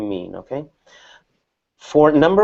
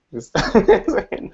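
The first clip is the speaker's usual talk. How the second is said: higher, said through a laugh; 8 semitones higher than usual. Real, not acted. Teacher joking with the class, laughing. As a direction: let a laugh break into the words.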